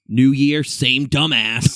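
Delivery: imitated taunt. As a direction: call it out loud, drawing out the end. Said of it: "just goes".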